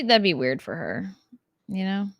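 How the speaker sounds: ordinary delivery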